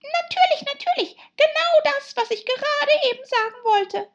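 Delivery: extremely high, loud squeak; half-asleep dormouse. Acted. Dormouse woken by hot tea, loud, high squeaking. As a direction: squeak agreement hurriedly from sleep. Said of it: "ohne die Augen zu öffnen".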